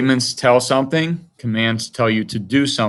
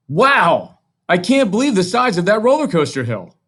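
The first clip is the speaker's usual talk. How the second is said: high, bright burst of amazement; excited exclamation; 8 semitones higher, 3 dB louder than usual. Shown as a demonstration. Teacher reading an example exclamation to the class, high and excited. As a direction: read it like you're gasping at the giant hill. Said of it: "Notice how this sentence ends with an exclamation mark".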